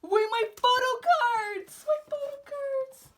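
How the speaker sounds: high, loud squeal, softening on repeat; excited squeal. Real, not acted. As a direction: cry out for a treasured find. Finding the photo cards.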